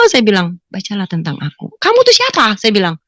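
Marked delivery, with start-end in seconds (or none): 0.7-1.7 low, soft retold answer; calm hushed instruction; quoted mysterious voice. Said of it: "suara itu cuma bilang". 1.8-2.5 high-pitched retold challenge; impatient high question; exasperated demand. Demands the voice's name.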